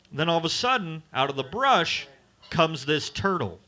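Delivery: ordinary talk, nothing marked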